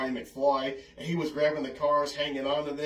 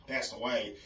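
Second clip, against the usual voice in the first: normal talking voice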